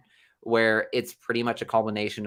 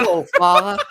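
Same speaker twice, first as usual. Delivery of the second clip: hammed-up mock film greeting; Harry Potter character; 7 semitones higher, 8 dB louder than usual. Co-host echoing friend's film impression, hammed-up. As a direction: echo the loud, higher character voice. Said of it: "who was the guy in Harry Potter".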